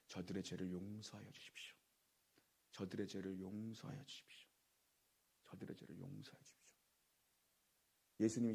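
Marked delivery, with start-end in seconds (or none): 0.1-6.8 very soft plea, fading away; hushed acted prayer. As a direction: murmur Jesus' plea for forgiveness under the breath. Preacher voices Jesus' prayer for forgiveness. (속삭이듯 나직이 간구하는 기도)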